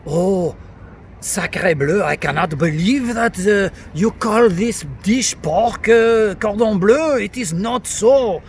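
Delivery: slow, indignant cartoon French accent; exaggerated French accent. Shown as a demonstration. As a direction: protest like an outraged over-the-top Frenchman. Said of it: "exaggerate, like a French guy".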